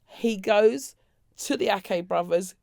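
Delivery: ordinary talk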